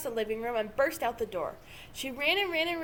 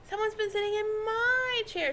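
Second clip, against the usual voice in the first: higher squeaky little cub voice; squeaky baby bear; 9 semitones higher than usual. A narrator's reading. Baby Bear finds his broken chair, squeaking tearfully. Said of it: "squeaked the baby bear".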